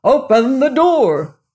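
loud, high, escalating demand; angry shout. Acted. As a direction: shout it with mounting fury. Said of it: "it was shouting".

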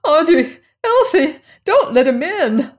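very high, loud pleading; panicked cry. Acted. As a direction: call out frantically in panic. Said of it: "cried Missus Stevens in a panic".

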